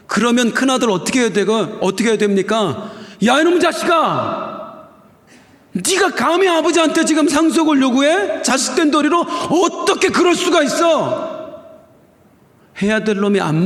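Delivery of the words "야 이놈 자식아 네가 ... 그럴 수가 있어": put-on scolding older brother. Older brother rebuking younger brother for demanding inheritance, high angry scold.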